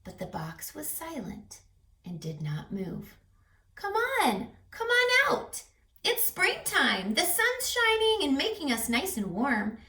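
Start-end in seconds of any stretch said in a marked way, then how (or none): 3.7-9.8 high, sing-song coaxing call. Acted. Animals coax whoever is in the box, coaxing. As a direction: coax the shy one out cheerfully.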